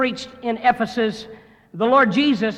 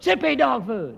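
mock crowd shout; 5 semitones higher than usual. Salesmen shouting back to their manager, mock rally chant. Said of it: "And they screamed out".

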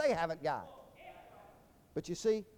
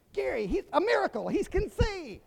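high-pitched awestruck exclaiming; hammed-up astonished onlookers, pitched way up; mock amazement; 10 semitones higher, 3 dB louder than usual. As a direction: cry out as if witnessing a wonder. Onlookers amazed by apparent miracle.

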